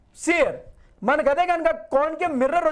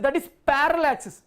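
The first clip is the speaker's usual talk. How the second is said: each word laid out slowly for the class; slow, deliberate; much slower than usual. Shown as a demonstration. Teacher to students, defining the parallel axis slowly. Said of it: "teacher stresses key definition".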